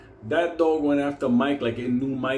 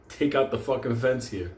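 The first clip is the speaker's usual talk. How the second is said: ordinary talk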